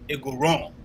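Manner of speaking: ordinary delivery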